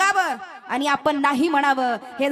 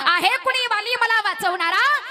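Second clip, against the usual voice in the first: desperate call for help; 6 semitones higher than usual. Retold or imitated. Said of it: "आवाज दिला".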